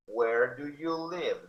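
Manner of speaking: Where do you live slow, close to normal pitch; slow model. Shown as a demonstration. Teacher repeating for students, slow. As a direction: repeat it slowly.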